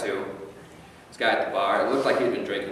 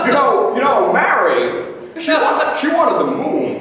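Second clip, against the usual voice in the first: Jimmy Stewart impression; 7 dB louder than usual. The drunk patron offering Davey the moon, high, loud and stammering. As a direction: beg to lasso the moon, stammering and rising. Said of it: "He goes by Mister Stewart".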